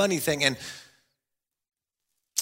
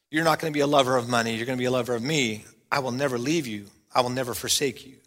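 tender; 6 semitones lower, 4 dB quieter, faster than usual. Acted. God's voice paraphrased.

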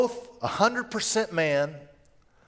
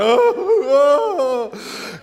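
loud laugh; 10 semitones higher, 7 dB louder than usual. Real, not acted. Preacher laughing at his teary movie memory, loud laugh. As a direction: burst of high, loud laughter.

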